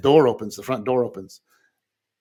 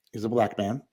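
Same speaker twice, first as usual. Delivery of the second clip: usual speaking voice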